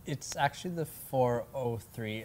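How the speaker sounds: "four oh three" said as digits; correction. Shown as a demonstration. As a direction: say it "four oh three", not "hundred". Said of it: "on the four hundred and three".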